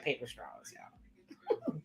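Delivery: whispered confession about straws. Real, not acted. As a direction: drop to a secret whisper. Confiding complaint about straws.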